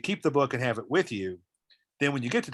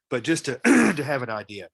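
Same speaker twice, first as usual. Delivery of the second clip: allergies; 6 dB louder than usual. Real, not acted. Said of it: "Sorry, my allergies are killing me".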